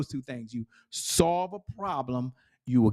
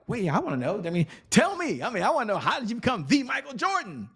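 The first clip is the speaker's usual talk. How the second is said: eager; 8 semitones higher than usual. Retold or imitated. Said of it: "And I was like".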